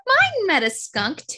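ordinary talk

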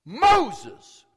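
high, loud call for attention; emphatic, far-carrying shout. Shown as a demonstration. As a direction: holler the name with full force. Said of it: "He called out to him".

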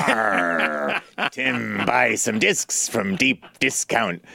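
slow pirate puppet; drawn-out pirate pitch. Pretend. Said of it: "being a puppeteer".

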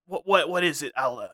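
lightly voiced alien shopkeeper doing a faux British-American accent; a hint of a weary, brooding Watto; Reboot Watto. Podcast bit, Watto greets co-host Alan, lightly voiced. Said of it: "I'm Reboot Watto".

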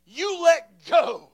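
high, loud, drawn-out order; commanding shout. Acted. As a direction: order death to release its hold. Acted command to death.

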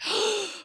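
high, breath-sucking gasp; imitated gasp. Mom reenacting her reaction to daughter's turnaround, astonished gasp. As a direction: suck in air, astonished. And I was like.

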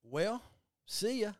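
put-on high, bright kid farewell; chirpy child saying goodbye. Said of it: "as the kid in Polar Express said".

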